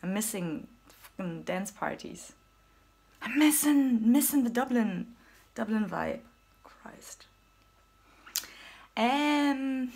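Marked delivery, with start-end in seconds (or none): 3.2-6.2 loud lament. Real, not acted. Lamenting missing Dublin. 6.6-7.3 breathy whispered curse; whispered exasperated Christ. Real, not acted. Exasperated aside.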